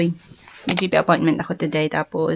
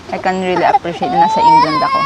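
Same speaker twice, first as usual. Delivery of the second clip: high, loud excited shout; 6 dB louder, faster than usual. Shown as a demonstration. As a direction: yell it out with joy. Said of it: "re-, I can really appreciate".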